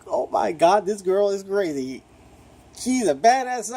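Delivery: high-pitched gushing quote; excited mock reaction; amazed reaction. Acted amazed reaction to the character.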